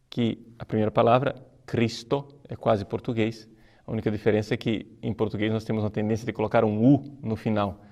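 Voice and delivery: ordinary delivery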